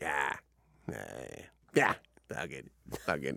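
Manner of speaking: low, soft mumbling brush-off; mumbled brush-off. Retold or imitated. Brothers shrugging off the wife, low mumble. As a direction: mumble it off like it's nothing.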